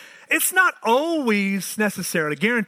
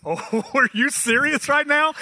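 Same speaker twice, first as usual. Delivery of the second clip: laughing disbelief; 6 semitones higher than usual. Retold or imitated. Incredulous reply to wife.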